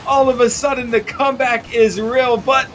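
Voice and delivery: high, loud thrilled call; excited play-by-play. Real, not acted. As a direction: ride the comeback hype. Caster reacts to comeback goal.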